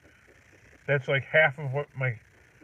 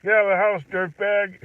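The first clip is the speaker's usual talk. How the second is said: loud, higher mock order; mock yell at kid; 6 semitones higher, 6 dB louder than usual. Dad teasing his kid to get out of the house, loud mock yell. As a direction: bark it like a gruff dad. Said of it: "when I talk like that".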